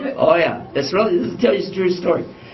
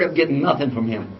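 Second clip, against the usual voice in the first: ordinary talk, nothing marked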